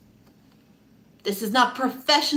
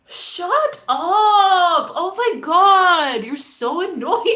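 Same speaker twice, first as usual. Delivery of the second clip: loud, high mock whine, drawn out; exasperated kid; 6 semitones higher, 4 dB louder, much slower than usual. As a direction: wail it like an annoyed child. Child self snapping at classmates.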